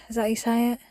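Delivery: quiet doubtful aside; quiet self-question. Real, not acted. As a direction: ask it half under your breath. Unsure of a word, asking herself.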